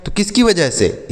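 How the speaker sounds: usual speaking voice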